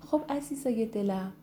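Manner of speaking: ordinary delivery, nothing marked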